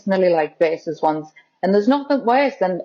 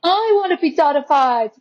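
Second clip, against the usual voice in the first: higher-pitched imitation of eager clients; mildly eager; 7 semitones higher than usual. Everyone asking for the photographer's treatment, playfully eager. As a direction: pitch it up, lightly mimicking keen admirers.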